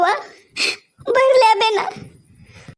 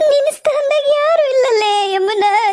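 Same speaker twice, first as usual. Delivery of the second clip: higher and slowed, sobbing; crying; 6 semitones higher than usual. Acted. Mother telling daughter nobody can replace her, in tears. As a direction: let the grief pull the words out slowly.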